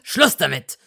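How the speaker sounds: loud sharp cut-off; sharp shout. A narrator's reading. Malfoy silencing Hermine with a shout. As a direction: bark it to silence her. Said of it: "unterbrach er sie scharf".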